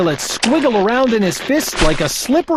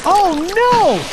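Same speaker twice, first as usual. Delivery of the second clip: horrified cry; 11 semitones higher than usual. Acted. Bartholomew horrified.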